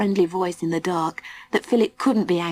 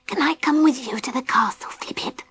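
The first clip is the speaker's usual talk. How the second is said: whispering; 6 semitones higher than usual. A narrator's reading. Baby Bee asks softly.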